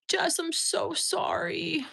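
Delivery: high, soft, slow commiseration; tender sympathy. Real, not acted. Streamer consoling viewer Jess, high soft sympathetic voice. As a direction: draw it out softly, aching for them.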